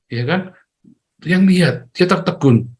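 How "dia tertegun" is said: ordinary delivery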